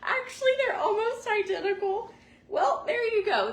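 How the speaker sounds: high, loud and thrilled with a laugh; excited squeal. Real, not acted. Opening a gift at home, high loud squeal. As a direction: gush over the surprise, near tears.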